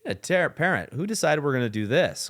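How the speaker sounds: joking. Shown as a demonstration. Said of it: "Kind of jokingly with her".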